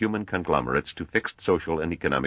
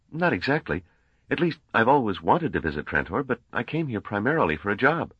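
higher, lightly voiced young man, polite; Gale's voice; 7 semitones higher than usual. A narrator's reading. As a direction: lift the pitch for earnest young Gale. Narrator voices Gale.

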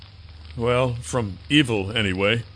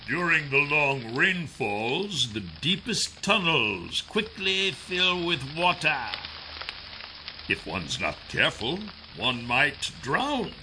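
slow, much softer, ominous; ominous quiet villain; 5 semitones higher, 6 dB quieter, slower than usual. Acted. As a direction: let the threat sink in slowly. Kryzak ominous.

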